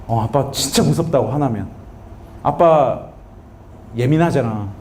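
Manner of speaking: low, halting, hesitant admission; subdued child's confession. Retold or imitated. Crying son admitting fear to dad, subdued.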